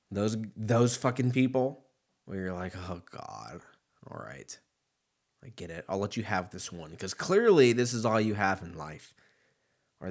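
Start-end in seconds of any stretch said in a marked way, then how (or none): 2.9-7.0 lower, much softer, weary give-in; low, quiet, long-suffering concession; resigned. Retold or imitated. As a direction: sigh it out, humouring them. Resigned reply to an office joker.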